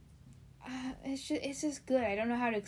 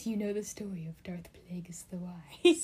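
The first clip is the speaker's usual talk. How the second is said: hushed, secretive whisper. Pretend. Quoting a meme line.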